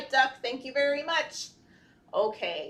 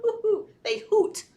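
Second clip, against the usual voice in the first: laughing owl hoots, high; 11 semitones higher, 3 dB louder than usual. Shown as a demonstration. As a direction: hoot like an owl while giggling. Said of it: "they say".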